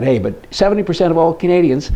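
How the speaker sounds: normal talking voice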